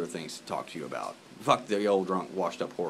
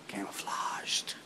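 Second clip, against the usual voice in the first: whispered joke; 8 dB quieter, much slower than usual. Real, not acted. Joking about hidden friend.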